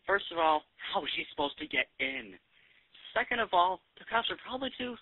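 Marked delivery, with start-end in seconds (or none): none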